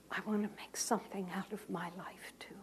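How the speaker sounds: soft unvoiced whisper; a young man whispering a hope. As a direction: confide it in a hush. Whispering clerk confides.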